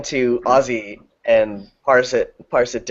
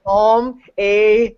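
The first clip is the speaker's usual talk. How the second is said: high-pitched mock Canadian; imitated Canadian accent; 8 semitones higher than usual. Recalling what the Canadian concertgoer said, imitated Canadian accent. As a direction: mimic her Canadian vowels, pitched up. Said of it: "everything you said was Canada".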